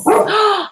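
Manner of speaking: sharp high gasp of delight; excited gasp. Real, not acted. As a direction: gasp as the merch appears. Merch delivery arrives mid-stream.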